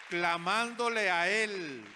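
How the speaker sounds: loud, slow rallying shout; forceful, drawn-out preaching climax; preacher's cry. Real, not acted. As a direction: stretch it out and call it to the crowd. Preacher answers own question emphatically.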